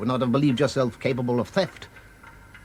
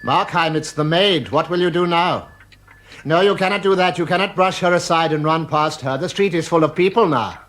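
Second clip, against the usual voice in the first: higher, urgent alarm as danger nears; urgent warning; 7 semitones higher, 4 dB louder than usual. Acted. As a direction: hurry him, the maid is at the door. Visitor warns the maid is coming.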